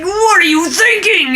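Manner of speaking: high, slow incredulous cry; exasperated disbelief at the screen. Retold or imitated. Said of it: "I'm like".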